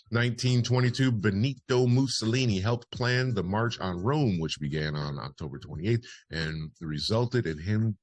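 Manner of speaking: punchy, projected history bulletin; news read. Acted. Host reads listeners this day in history, news read. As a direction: announce it like a radio newsreader.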